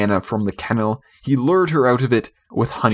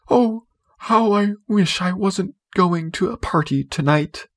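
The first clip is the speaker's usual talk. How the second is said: anxious cry; 8 semitones higher than usual. A narrator's reading. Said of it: "made her cry".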